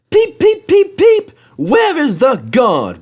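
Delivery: very high, loud whistle-like peeps; whistling. Acted. Thomas whistles for the guard, very high and loud. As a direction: peep it like an engine whistle. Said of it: "whistled Thomas".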